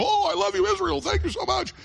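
high, sugary mock thanks; mocking gratitude. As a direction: gush it like a fake fan. Mocking a forced thank-you to Israel.

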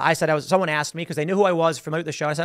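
ordinary talk, nothing marked

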